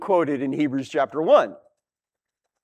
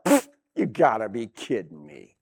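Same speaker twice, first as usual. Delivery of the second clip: low, dismissive pfft; scoffing; 7 semitones lower, 6 dB quieter than usual. Retold or imitated. God scoffing at the rebelling nations, low and dismissive. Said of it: "He's like".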